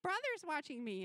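put-on high-pitched little kid voice; imitated small child. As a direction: pipe up like a three-year-old. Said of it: "small children".

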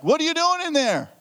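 high-pitched exasperated demand; getting mad. Acted. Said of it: "I'm getting mad".

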